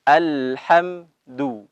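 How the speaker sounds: slow Alhamdu with rounded lips on dal; Quranic recitation. Shown as a demonstration. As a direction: stretch out Alhamdu, lips pushed forward. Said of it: "harus dimonyongkan". (bacaan pelan dengan bibir dimonyongkan)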